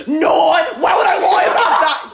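high, shouted, laughing indignation; mock angry outburst. Man denying to a woman that he faked it, mock-furious. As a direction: blow up in hammed-up outrage. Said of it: "starts getting so".

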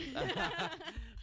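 real high squealing laugh; cracking up at her. Real, not acted. Laughs that friend doesn't know.

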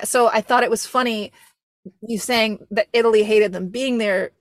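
normal talking voice

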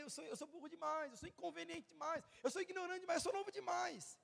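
climbing steadily into high-pitched self-deprecation; protesting excuses. Acted. Preacher as Moses making excuses to God, increasingly high-pitched protest. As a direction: plead inadequacy, pitch rising with each excuse.

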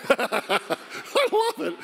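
real laugh through the words. Real, not acted. Preacher laughing at the story. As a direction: laugh it out, delighted.

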